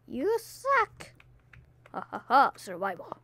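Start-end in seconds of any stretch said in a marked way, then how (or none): 0.1-1.1 high, sing-song you suck; a high, teasing jab; high taunt. Pretend.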